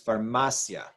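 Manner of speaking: "farmacia" as far-MA-sya; blended vowels. Shown as a demonstration. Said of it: "blend together to make one sound".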